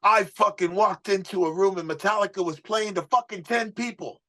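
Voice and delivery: high, put-on voice of the gruff mentor; imitating Johnny. Manager scolding the young singer, high put-on voice. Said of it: "He'd be like".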